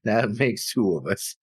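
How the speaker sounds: slow repeat through a laugh. Real, not acted. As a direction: laugh while saying it again.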